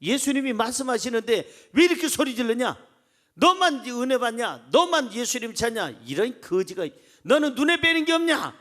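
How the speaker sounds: high-pitched indignant rebuke; mock scolding. Preacher as the crowd rebuking the blind beggar, acted scolding. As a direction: act out a crowd telling off a beggar. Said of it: "꾸짖었어요".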